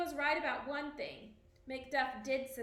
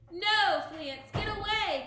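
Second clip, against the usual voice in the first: urgent gasp, high and loud; gasped warning; 6 semitones higher, 8 dB louder than usual. A narrator's reading. Dying father gasping at his son to flee. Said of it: "gasped Banquo".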